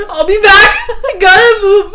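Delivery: high, loud words through laughter; helpless laughing outburst; laughing hard. Real, not acted. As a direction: squeal it out while cracking up. Cracking up laughing.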